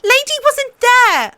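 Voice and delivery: put-on young sister shouting in defence; angry shout. A narrator's reading. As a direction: yell it at the queen. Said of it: "Arya shouted angrily".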